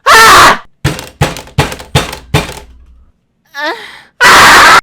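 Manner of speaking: loud high scream then hoarse shout. Acted. Goofing, loud scream. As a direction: let out a piercing scream.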